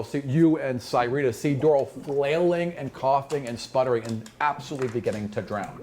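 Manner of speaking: ordinary delivery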